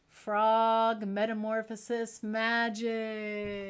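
slow mock-mysterious magician spell; hammy magician for children; kids party magician. Performs magic trick for kids.

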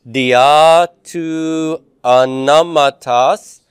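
very slow, emphatic syllable-by-syllable Greek; reading aloud together. Shown as a demonstration. Teacher leading the class in reading Greek aloud, slow and deliberate. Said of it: "let's read this, uh, together".

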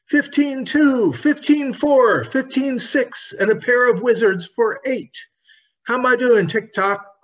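put-on high, bright girl's voice, eager and loud; a young girl crying out in glee; excited little girl. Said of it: "cried little Betsy Bobbin".

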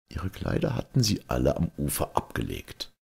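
plain reading voice

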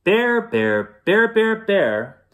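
high rhythmic yell for a bear; storybook yelling; yelled call. A narrator's reading. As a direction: call out loudly in a repeated chant. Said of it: "and he yelled".

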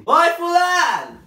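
loud, high, drawn-out shout out the window; neighbor shouting. Retold or imitated. Neighbor lady yelling out the window, high and loud. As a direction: yell across to the neighbor. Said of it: "fica assim".

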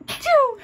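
a real sudden sneeze, high and loud. Real, not acted. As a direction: let out a big sneeze. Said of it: "Excuse me".

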